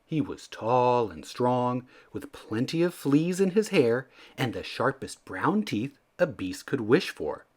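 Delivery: plain reading voice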